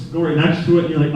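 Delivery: normal talking voice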